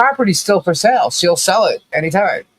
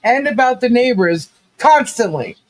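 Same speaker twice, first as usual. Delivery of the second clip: higher, mocking disbelief; incredulous retort; 6 semitones higher than usual. Telling Travis off about the drama channel, incredulous and mocking. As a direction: throw his words back at him. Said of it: "I'm like".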